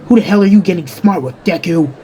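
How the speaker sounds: high-pitched, heated bully; angry challenge. Acted. Bakugo reacts to Izuku's backtalk, angry challenge. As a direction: challenge him for talking back.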